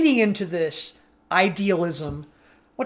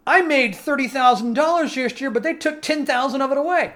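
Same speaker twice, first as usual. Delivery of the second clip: lightly voiced upset new taxpayer, higher; mock protest, pitched up; indignant complainer; 6 semitones higher, 3 dB louder than usual. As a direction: complain in raised pitch about being shortchanged. Voicing an indignant young taxpayer.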